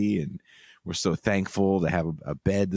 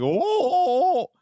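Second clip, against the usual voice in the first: mock frightened yelps; 5 dB louder, slower than usual. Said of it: "I'm like".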